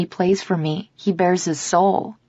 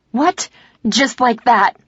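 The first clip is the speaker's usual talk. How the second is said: high-pitched cry of shock; shocked scream; 6 semitones higher, 3 dB louder, slower than usual. A narrator's reading. Woman stunned by her partner quitting, mild shocked scream. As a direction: burst out in disbelief, short of a full scream. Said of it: "I scream".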